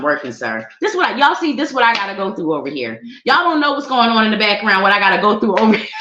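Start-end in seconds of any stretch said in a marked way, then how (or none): 4.8-5.9 fast, loud playful complaint; mock exasperated shout. Real, not acted. Teasing her laughing husband, a fast playful shout.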